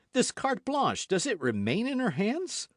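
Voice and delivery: high, urgent repeat of carte blanche; eager, pressing question. A narrator's reading. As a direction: press hard for the answer. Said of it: "And this carte blanche".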